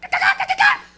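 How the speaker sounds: high, loud hyena cackle; hyena laugh. Retold or imitated. Comic points at the stoned crowd, imitated hyena laugh. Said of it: "hyenas in fucking movies".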